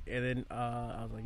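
usual speaking voice

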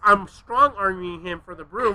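ordinary delivery

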